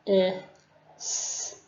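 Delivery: k, i, s said separately, s hissed; a kid sounding out k i s; sounding out letters. Shown as a demonstration. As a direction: sound out each phoneme. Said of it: "The kid would say".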